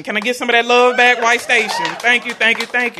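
calling out to crowd. Real, not acted. Calling out to audience.

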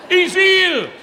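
"He's healed" high and loud; declaring victory to the crowd; triumphant shout. Shown as a demonstration. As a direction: proclaim it triumphantly. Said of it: "That's English for".

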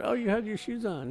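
high, breezy good witch; Glinda voice. Retold or imitated. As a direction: sweetly point out the obvious.

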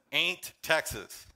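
"Ain't Texas" stretched slow and long; joking drawl. Retold or imitated. Pastor joking about the map, slow mock drawl. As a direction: drag out "Ain't" as a joke. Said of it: "It just says".

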